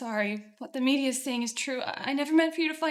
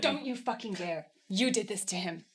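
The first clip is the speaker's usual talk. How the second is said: quiet fury; 6 semitones lower, 4 dB quieter than usual. Acted. Daughter accuses brother.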